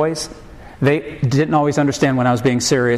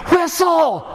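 very high, stretched-out mock holler; hammed-up high-pitched call from a distance; imitated yell. Wife on the porch to husband in the garage, imitated yell. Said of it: "I hear her yell to me".

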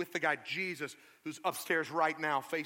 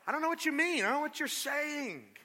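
high, loud panicky denial; flustered denial; 12 semitones higher, 7 dB louder than usual. Acted. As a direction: brush them off in a panic. Peter's flustered denial acted.

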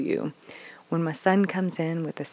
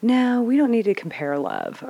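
retold objection; 7 semitones higher, 4 dB louder than usual. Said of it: "And I'm like".

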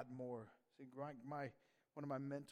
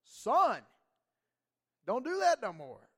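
much louder and higher, a father's shout; stern rebuke; 9 semitones higher, 17 dB louder, slower than usual. Retold or imitated. A father rebuking his hard-headed son, loud stern shout. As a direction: bark it out like a firm father. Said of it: "Sometimes, like".

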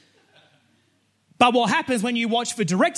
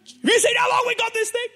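much higher, gushing with excitement; thrilled. Retold or imitated. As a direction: squeal it like a kid with a treat. Thrilled renter, seven-day rental.

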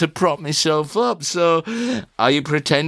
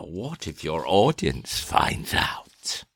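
whisper; 9 semitones lower, 6 dB quieter than usual. Acted. Interrogator leans in to threaten.

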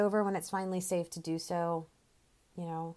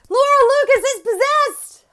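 much higher, much louder panicked yelling; 18 dB louder than usual. Retold or imitated. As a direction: shout to wake a sleeping mother in terror.

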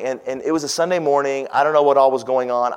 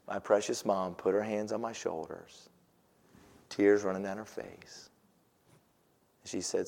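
much softer and lower, sincere; tender hush; 5 semitones lower, 15 dB quieter than usual. Real, not acted. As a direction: speak gently as mother apologizing. Tender retelling of mom's apology.

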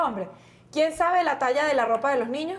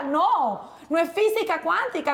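usual speaking voice

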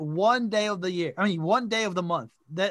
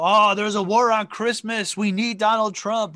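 mock outraged conservative; 3 semitones higher, 7 dB louder than usual. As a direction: ham up an outraged right-winger. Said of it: "and, you know".

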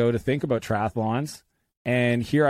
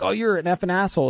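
mock offended; 9 semitones higher, faster than usual. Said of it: "They're like".

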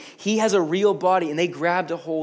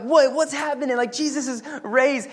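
freaking out; 7 semitones higher, 3 dB louder than usual. Retold or imitated. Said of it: "They're like".

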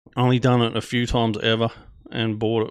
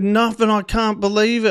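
raised pitch, mildly shocked protest; shocked denial; 9 semitones higher, 3 dB louder than usual. Retold or imitated. Traveller answering the officer who found marijuana, shocked. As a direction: insist you are amazed yourself. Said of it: "I was in more in shock".

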